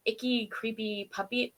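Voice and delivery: ordinary talk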